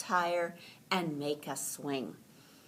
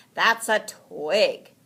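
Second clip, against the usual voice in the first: slow, louder correction; Franklin correcting; 4 semitones higher, 9 dB louder, slower than usual. A narrator's reading. Franklin tells Rabbit the twig is no tree, slow and loud. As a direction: spell it out slowly. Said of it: "Franklin explained".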